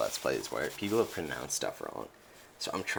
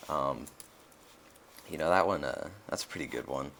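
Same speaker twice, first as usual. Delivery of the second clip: ordinary talk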